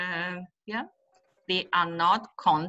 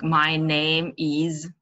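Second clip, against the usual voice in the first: slow, loud, separate "is"; stressed linking verb; 5 dB louder, much slower than usual. Shown as a demonstration. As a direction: punch "is" as a full word. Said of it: "one of the common, uh, mistakes".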